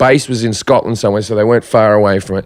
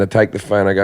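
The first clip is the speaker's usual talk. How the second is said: ordinary delivery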